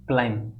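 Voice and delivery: क्लाइंब ending on M, no B; silent B. Shown as a demonstration. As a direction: say क्लाइंब without sounding its B. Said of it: "इनमें बी silent होता है".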